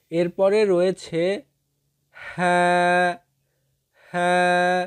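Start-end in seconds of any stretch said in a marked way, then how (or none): 2.2-4.8 হ্যাঁ as Arabic ha from mid-throat, vowel held; sustained letter-drill হ্যাঁ; demonstrated letter sound. Shown as a demonstration. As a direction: model a long, level হ্যাঁ from the middle of the throat. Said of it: "কণ্ঠনালীর মধ্যভাগ".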